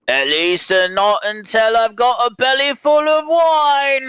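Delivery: high, rowdy tavern yell. Acted. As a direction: yell it out to the whole bar. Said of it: "and yells".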